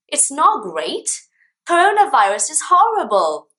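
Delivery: hammed-up dismay; acted indignant protest. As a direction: object like a baffled learner, building to an outcry. Acts a confused learner objecting.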